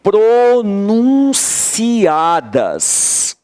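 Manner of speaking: PRONUNCIADAS drawn out very slowly, syllable by syllable; key-word emphasis. Shown as a demonstration. Teacher underlining the key word for the class, emphatically stretched. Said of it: "Essa é a palavra-chave".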